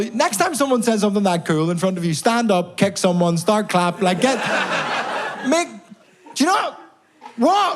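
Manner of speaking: high, spluttering indignation; worked-up comic rant; exasperated scolding. Acted. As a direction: sputter in disbelief at the crowd. Scolding crowd for not clapping.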